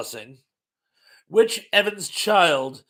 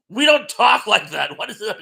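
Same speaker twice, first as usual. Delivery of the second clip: higher, louder retold protest; mock indignant outburst; 5 semitones higher, 8 dB louder than usual. Retelling a reaction to the silly accent, mock indignant. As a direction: object loudly, acting out the disbelief.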